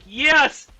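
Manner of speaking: loud, cheering yes; voicing Rexy's triumph. Vlogger cheering Rexy's win over the Spino, loud triumphant shout. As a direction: shout it like a victory roar. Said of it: "which is like".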